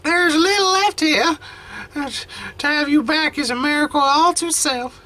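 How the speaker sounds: put-on a frail old grandmother, tender and teary; grandma voice. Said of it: "I lost the grandma voice".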